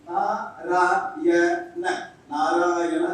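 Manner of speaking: நாராயண said slowly, every sound in place; a careful demo of நாராயண; exact pronunciation. Shown as a demonstration. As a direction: sound out நாராயண just as written. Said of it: "as it is you have to pronounce".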